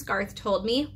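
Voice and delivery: ordinary delivery, nothing marked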